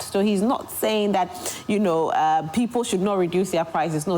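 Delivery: ordinary talk, nothing marked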